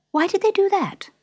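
high, questioning character voice; curious Alice. A narrator's reading. Alice interrupting the Dormouse's tale, curious.

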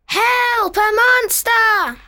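high loud panicked cries; frightened screams. Acted. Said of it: "Candid screams".

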